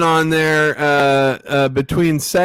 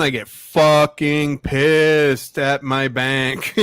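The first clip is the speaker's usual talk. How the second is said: ordinary talk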